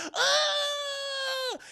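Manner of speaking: long, very high shriek; hammed-up horror-film scream; mock scream. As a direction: scream in horror at a shocking find. She finds body, screams in retelling.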